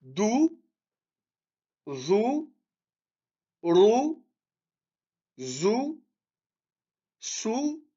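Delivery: each syllable said alone and held, ou, bou, tou; teacher calling out syllables one by one; syllable drill. Shown as a demonstration. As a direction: model each sound for students to repeat. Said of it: "La deuxième ligne".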